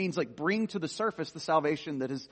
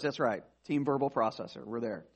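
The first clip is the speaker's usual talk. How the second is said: ordinary talk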